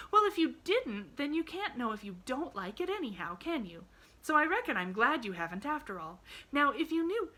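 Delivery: put-on high child voice with swooping pitch; glad little girl, reasoning. A narrator's reading. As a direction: reason it out cheerfully in a girlish pitch.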